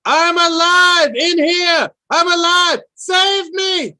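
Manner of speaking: very high, very loud desperate cry. Acted. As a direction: scream it out with everything left. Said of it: "Then able to shout louder".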